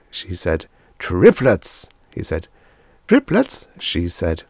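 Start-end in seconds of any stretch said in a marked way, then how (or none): 1.0-1.9 louder, punchy announcement; announcing news. A narrator's reading. Doctor breaks birth news to receptionist, announcing. 3.1-3.7 high, loud astonished question; exclaiming. A narrator's reading. Receptionist hears of the triple birth, exclaiming. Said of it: "She was exclaiming".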